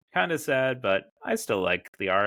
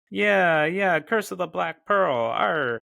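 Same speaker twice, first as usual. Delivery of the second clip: high, hammed-up gangster pirate; badly faked mobster pirate, loud; Captain Jack Sparrow; 8 semitones higher, 5 dB louder, slower than usual. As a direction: growl out a comic pirate arr. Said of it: "Captain Jack Sparrow being like".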